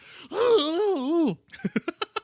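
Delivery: high laughing gasp; berserk gasp. Retold or imitated. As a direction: gasp like a maniac. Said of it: "He's like".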